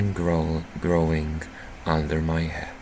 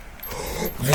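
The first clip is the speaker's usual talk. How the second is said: sudden startled intake of breath; sharp gasp; 7 semitones higher, 7 dB quieter than usual. Real, not acted. Shocked player gasping at the twist.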